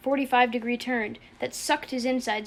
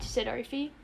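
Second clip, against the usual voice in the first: plain reading voice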